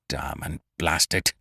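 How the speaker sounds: grated through teeth. A narrator's reading. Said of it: "he grated through his teeth".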